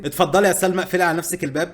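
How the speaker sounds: calling loudly to the cat. Real, not acted. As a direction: shout the order across the room. Ordering Salma out, louder.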